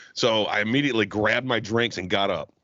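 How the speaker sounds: ordinary delivery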